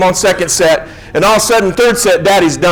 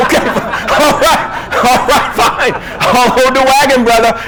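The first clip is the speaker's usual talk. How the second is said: higher, slow, chuckling giving in; laughing agreement; 6 semitones higher than usual. Retold or imitated. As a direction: laugh it off and give in, pitched up. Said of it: "And I was like".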